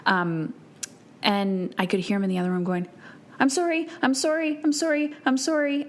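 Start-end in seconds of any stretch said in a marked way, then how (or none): 3.4-5.8 put-on higher-pitched, repeated little-boy apology; high-voiced retold fretting; imitating anxious child. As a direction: mimic a sensitive child apologizing over and over.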